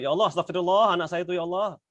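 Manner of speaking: a fed-up parent's mock-angry astagfirullah; exasperated complaint. Shown as a demonstration. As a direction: blurt it out annoyed, blaming the child. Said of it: "Jangan astagfirullah itu".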